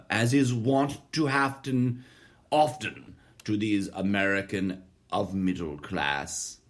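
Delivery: lightly put-on, slowed British accent for a controversial line; a hint of a British accent, drawn out; mock British accent. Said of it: "Why do I do a British accent".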